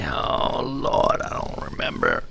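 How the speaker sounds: hushed, breath-heavy groan of defeat; muttered exasperation. Real, not acted. Host losing track of the record's name, muttering in exasperation. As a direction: let it fade under your breath, giving up. Gives up trying to remember.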